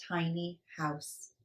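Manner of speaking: hushed, tiny words; breathy hush on tiny; whispered. A narrator's reading. Teeny tiny house whispered.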